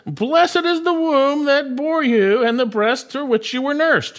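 a hint of a woman crying out from the crowd. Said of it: "raised her voice and said to him".

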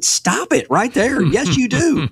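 high-pitched, insistent objection, played up with good humor; emphatic mock protest. Counselor corrects someone who claims no testimony, emphatic mock protest. Retort to people doubting their testimony.

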